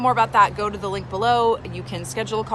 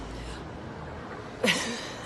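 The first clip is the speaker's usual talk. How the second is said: laugh; 3 semitones higher, 9 dB quieter than usual. Real, not acted. Laughs at own example.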